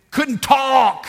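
high, loud outburst; exclaimed frustration. Real, not acted. Preacher on two days without a voice, exclaiming loudly. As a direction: burst out with it suddenly. Preacher bursts out after hushed line.

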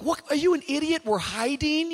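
loud, high exasperated snap; exasperated Eve. Retold or imitated. Eve scolding Adam for answering God, exasperated. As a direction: scold him for giving them away. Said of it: "I can see Eve like".

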